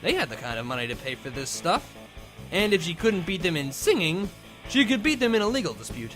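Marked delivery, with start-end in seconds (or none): none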